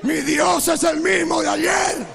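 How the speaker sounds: slow, drawn-out preacher's shout; loud, elongated pulpit cry; defiant proclamation. Retold or imitated. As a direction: declare it at full force against the devil. Rebukes devil, proclaims to congregation.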